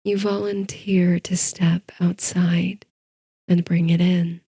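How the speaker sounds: ordinary delivery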